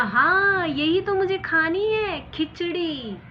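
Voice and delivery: loud, high, thrilled cry; husband thrilled he found the word; excited shout. Acted. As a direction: leap at the word in delight. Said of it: "उछल के पड़ा".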